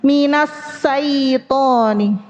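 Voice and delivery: slow MINAS SAITONI with a thin s for sh; shin turned into sin. Shown as a demonstration. Teacher showing lost airflow, shin becomes sin. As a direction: say SAITONI with s, no spread of air. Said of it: "Kalau hilang anginnya jadinya sin".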